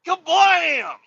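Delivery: high, loud imitated crash; shouted door-slam sound. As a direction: yell the slam as one long sound.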